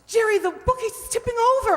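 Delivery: alarmed warning. Acted. Bookcase falling, warns husband.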